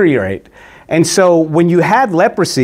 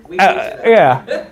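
laughing through the words; chuckling it off; 6 semitones higher than usual. Real, not acted. Laughs off his own aside.

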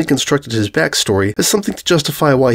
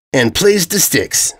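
proud; 6 semitones higher, slower than usual. A narrator's reading. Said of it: "proudly declared".

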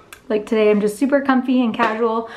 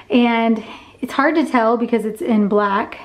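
ordinary talk, nothing marked

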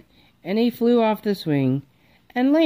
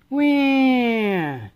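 high put-on baby wail; 6 semitones higher than usual. A narrator's reading. Baby Polly crying for her mother, high wail. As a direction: wail like a newborn.

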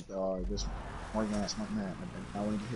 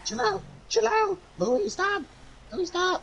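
high shrieking plea; panicked screaming; slower than usual. Real, not acted. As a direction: shriek for them to stop. Screaming at a pursuer.

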